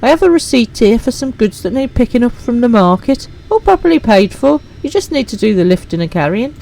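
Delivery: well-spoken companion asking for a delay; companion NPC voice. Acted. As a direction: play the courteous travelling merchant.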